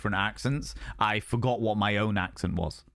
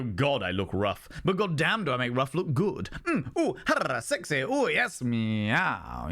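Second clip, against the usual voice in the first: mock vain self-admiration, swooping higher; preening at the mirror; 4 semitones higher than usual. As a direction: ham up flirting with your own reflection. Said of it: "and go".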